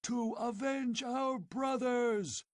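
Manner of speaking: slow, shrill howling war cry; howled. Acted. Tribesmen howling at Conan, vengeful. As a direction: howl for vengeance. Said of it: "they howled".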